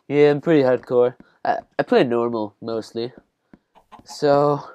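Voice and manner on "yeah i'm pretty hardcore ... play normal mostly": a loud, puffed-up hardcore gamer brag; mock tough boast. To viewers, mocking himself on normal difficulty, hammed-up brag. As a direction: boast like a tough guy, tongue in cheek. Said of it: "I'm like".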